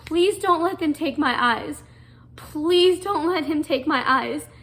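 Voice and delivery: desperate pleading. Retold or imitated. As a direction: plead with Mom not to let them take her eyes. Begging mom before eye surgery.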